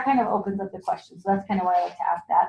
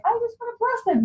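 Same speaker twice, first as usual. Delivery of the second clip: high, sweet, sarcastic piety; mock saintly; 13 semitones higher than usual. Consultant mocking her own pious pose after bad sales, high and sweet. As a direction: lightly overdo the angelic calm, pitched up. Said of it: "am I like".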